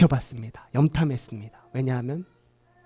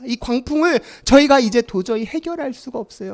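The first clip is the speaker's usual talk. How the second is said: loud, high frantic begging; alarmed, rising urgency; panicked plea; 7 semitones higher, 7 dB louder than usual. Acted. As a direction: plead as the boat goes down. Disciples panicking in sinking boat.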